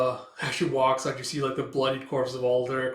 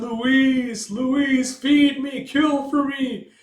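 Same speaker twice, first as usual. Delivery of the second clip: high, loud eldritch wail; drawn-out, high monster cry; monster calling; 12 semitones higher, 7 dB louder, much slower than usual. Acted. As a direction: call out hungrily, stretching the words. Monstrous voice calls to Louise.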